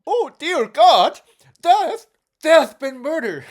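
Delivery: high, loud panicked butler; shocked butler. Acted. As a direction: cry out in horror as the butler. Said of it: "So, the butler".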